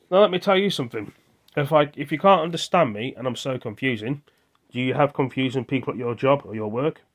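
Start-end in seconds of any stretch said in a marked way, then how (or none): 0.1-3.1 firm raised voice. Real, not acted. Confronting family who call him confusing.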